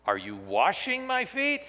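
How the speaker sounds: "washing" stressed, rising high into a question. Shown as a demonstration. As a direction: ask it with the weight on "washing". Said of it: "the focus isn't on".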